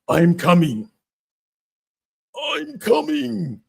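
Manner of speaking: very high, slow, stretched-out shout; Yuri Prohashka. Retold or imitated. Fighter warning he is coming, high drawn-out shout. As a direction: call it out like a wild samurai. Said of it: "Yuri like that".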